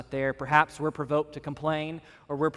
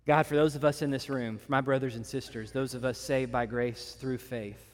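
prayer. Acted. Leads closing prayer.

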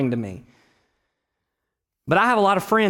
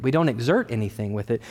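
ordinary delivery

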